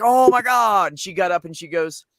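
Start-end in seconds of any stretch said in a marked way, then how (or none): 0.0-0.9 higher and louder alarmed cry; alarmed gasp. Retold or imitated. Onlookers seeing toddler fall, alarmed higher cry. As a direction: react as if a child just hit a rock. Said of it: "everybody was like".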